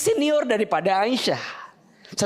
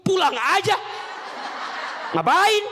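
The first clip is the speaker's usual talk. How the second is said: rude husband; 10 semitones higher than usual. Retold or imitated. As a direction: snap it like an annoyed husband. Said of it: "Mungkin kalau suami ibu".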